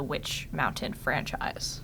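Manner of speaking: hushed dramatic whisper. Acted. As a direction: breathe it like a secret. Mock dramatic hush.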